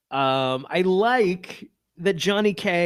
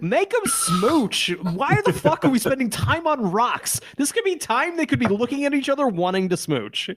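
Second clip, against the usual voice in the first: exasperated comic rant, pitched up; raging at the writers for laughs; mock rant; 7 semitones higher than usual. Ranting at the show's writers.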